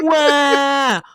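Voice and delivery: loud, high fake bawling; hammed-up crying for attention; mock wail. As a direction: bawl theatrically so he hears. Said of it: "Do I need to go louder?".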